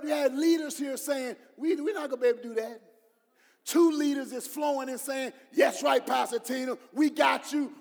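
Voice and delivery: loud, high, driving sermon voice; preaching at full force. Acted. Pastor preaching hard to the congregation about doubters. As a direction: shout it to the congregation.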